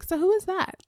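high, curious asking; puzzled question. Retold or imitated. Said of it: "I'm like".

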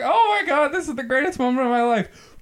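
very high, thrilled squeal; ecstatic squeal. Pretend. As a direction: squeal with delight. Voicing an ecstatic bee.

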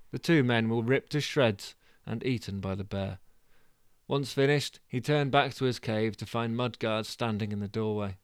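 ordinary delivery, nothing marked

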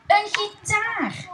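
high, slow delighted exclamation; excited. Acted. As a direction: savour the surprise of the guitar. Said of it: "Cas was al net zo opgewonden". (Cas die opgewonden zijn gitaar ontdekt)

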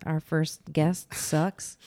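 normal talking voice

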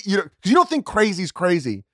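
normal talking voice

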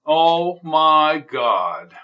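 loud, drawn-out horrified "Oh my God"; shocked gasp. Acted. Neighbor finding the body, horrified. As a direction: reel back at the sight of the body.

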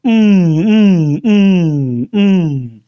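repeated loud, high falling hums; loud mock chorus of "mmm"; imitated crowd chorus. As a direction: mimic a whole hall jeering together. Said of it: "two hundred people in symphony just going".

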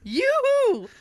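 high falling singsong call; sing-song yoo-hoo. Retold or imitated. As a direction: sing it out again, teasing.

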